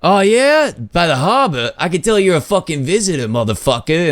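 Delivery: lightly imitated Boston accent, teasing; a hint of a Boston guy ribbing a tourist. Older punk teases a visitor, lightly imitated Boston accent. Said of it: "he's talking in a Boston accent".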